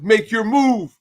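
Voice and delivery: exasperated shout in a retold rant; impatient yell. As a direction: yell at a slow driver. Impatient shouting at driver ahead.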